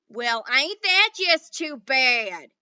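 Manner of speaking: loud, slow sneering drawl; mock his loneliness; sarcastic cowboy. A narrator's reading. Boone sneering at Little Bear, slow sarcastic cowboy. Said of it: "said Boone sarcastically".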